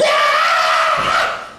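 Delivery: harsh, hoarse mock scream; imitated kid's scream. Kid bouncing around a restaurant, screaming. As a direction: let out a raspy bratty scream. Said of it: "screaming at the top of his lungs".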